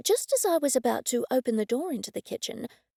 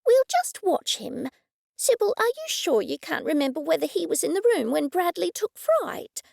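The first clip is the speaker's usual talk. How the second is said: higher, lightly voiced eager woman; a higher, excited Cressida; Cressida's voice; 7 semitones higher than usual. Cressida telling Sybil in the woodshed, higher and eager.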